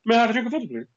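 high, posh elocution-lesson voice; posh, over-careful enunciation; posh talk. Retold or imitated. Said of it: "do that posh talk you've learned".

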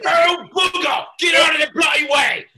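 high, very loud British road rage; mock British shout. Mad mail driver clearing the road, British-accented shout. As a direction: yell in a hammed-up British accent.

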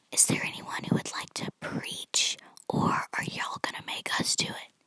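whispering the youth leader's question; whispered quote. Retold or imitated. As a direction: hush it like a secret. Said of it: "I don't know why I whispered that".

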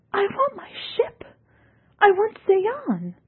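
high strained wail, slowing; desperate demanding cry. A narrator's reading. Ekarit demands, crying.

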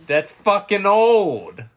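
mock disgust, dragging every word; drawn-out loud jeer. Real, not acted. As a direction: stretch it out, loud and high. Friend teasing about old candy.

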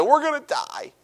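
high, mock-dramatic wail; overdramatic wail. Preacher mocks the Israelites' overdramatic cry of doom. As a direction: ham up the panic like a doomed crowd. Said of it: "A little overdramatic".

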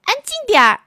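very high, put-on little boy shout; a cranky voice from inside the drawer; boy yelling from drawer. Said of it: "一个不满的声音".

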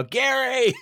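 high-pitched call for a dog; mock yell. Host imitating partner yelling for the dog from the deck, mock yell. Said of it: "go out on the deck and yell".

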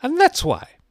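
very high, loud Duchess voice; loud, high-pitched character voice; brusque Duchess. A narrator's reading. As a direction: squawk the answer imperiously. Duchess snaps an answer at Alice.

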